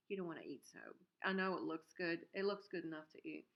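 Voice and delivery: usual speaking voice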